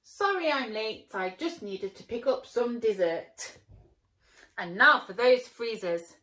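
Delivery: loud, confident hero; loud breezy hero. Acted. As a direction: swagger in. Hero returns.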